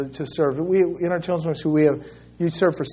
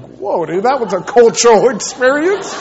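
amused; 6 semitones higher, 7 dB louder than usual. Retold or imitated. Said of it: "I'm like".

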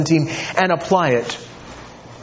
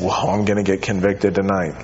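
worried husband; 10 semitones lower than usual. Retold or imitated. Imagined husband reacting, preacher voicing.

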